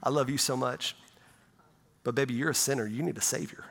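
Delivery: put-on soft, tender murmur to a baby grandson; gentle. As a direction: speak softly as if holding a newborn. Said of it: "I just so gently".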